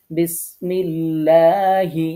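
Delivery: বিসমিল্লাহি chanted slowly, লা thin and lips unrounded; recitation with thin lam. Shown as a demonstration. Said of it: "লা পড়ব". (বারিক লা-তে ধীরে তিলাওয়াত)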